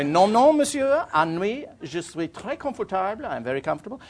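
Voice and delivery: put-on high, English-accented French, sure he has it right; confident schoolboy French. Planning his answer to the second Frenchman, proud schoolboy French. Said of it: "I'm getting better at this".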